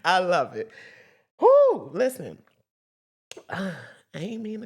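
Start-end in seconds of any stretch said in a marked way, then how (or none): 1.4-1.9 very high loud whooping shout; full church whoo. Real, not acted.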